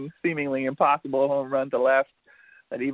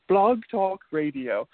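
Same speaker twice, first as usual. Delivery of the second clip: slow, higher station announcer; announcer intro; 6 semitones higher, slower than usual. Acted. Host restarts the show with a slow announcer intro. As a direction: announce it slowly like a jingle.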